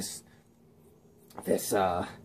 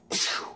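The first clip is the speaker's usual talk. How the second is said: short airy burst; quick voiceless "pchoo"; light bulb sound effect; 7 dB quieter than usual. Retold or imitated. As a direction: imitate a bulb popping.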